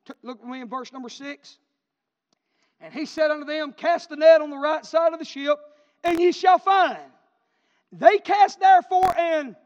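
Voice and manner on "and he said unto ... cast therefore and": loud, high scripture shout; preaching at full force. Real, not acted. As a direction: drive the verse home at the top of the voice.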